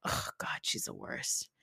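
whispering in disgust; a real whispered groan about her; whispered aside. Real, not acted. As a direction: drop to a breathy whisper of contempt.

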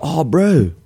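lightly mimicked New Zealand accent; a touch of Kiwi on "Oh, bro". Guest imitating how his brother speaks, light Kiwi accent. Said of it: "So when he speaks".

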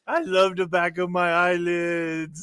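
put-on slow, drawn-out drunken adoration; imitated happy drunk. Drunk friend left in hotel room, slow mock adoration. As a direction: stretch it out like a blissed-out drunk. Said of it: "you're just like".

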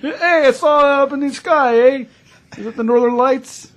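high, loud hammed-up Canadian accent, laughing; mock Canadian. Spotting a UFO over Canada, a high, loud hammed-up Canadian accent. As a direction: play a goofy Canadian with an eh.

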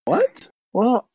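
high-pitched startled mom giving in slowly; a high squeal of surprise, then drawn-out reluctance; surprised mom. Acted. Mom is asked to cook fried chicken, surprised. Plays Jeremiah's mom hearing dinner request.

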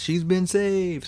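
high, slow mock announcement of good news; messenger's announcement. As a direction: call out the rescue news, drawn out. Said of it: "someone busts in and says".

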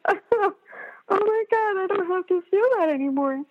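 high, amazed burst of relief; high-pitched elated realization; sudden relief. Retold or imitated. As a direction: gasp it out as if suddenly freed.

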